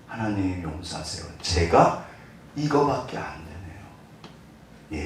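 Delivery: quiet prayer. Acted. Confessing prayer.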